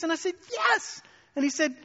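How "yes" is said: very high, emphatic yes; exasperated yes. Retold or imitated. Guilty student answering his teacher, desperately emphatic. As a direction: squeal it in mock exasperation.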